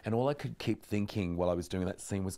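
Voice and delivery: ordinary talk, nothing marked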